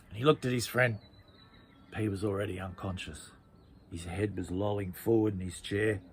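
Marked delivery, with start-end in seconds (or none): none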